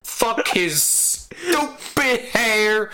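high, drawn-out indignant rant; hammed-up indignation; mock outrage. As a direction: rant it high and stretch the words. Ranting, acted abuse of someone.

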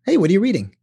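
friendly casual question. Retold or imitated. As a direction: brightly ask your partner. Casually greeting partner reading.

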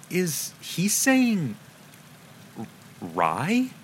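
high, slow, puzzled woman's voice; lightly voiced curious woman. A narrator's reading. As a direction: ask it wondering, drawn out. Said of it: "said the woman curiously".

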